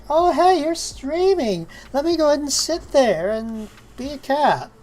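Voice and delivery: high, loud mock cat voice; voicing the cat. Voicing the cat's thoughts.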